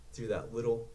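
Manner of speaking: ordinary delivery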